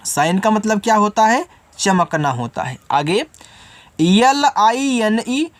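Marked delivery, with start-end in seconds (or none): 4.0-5.5 L I N E spelled very slowly letter by letter; drawn-out letter spelling. Shown as a demonstration. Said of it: "यल से आपका ला हो गया".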